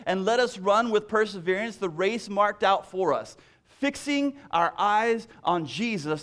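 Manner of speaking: booming Bible reading that slows on Jesus; proclaimed scripture. Acted. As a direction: proclaim the verse to the congregation.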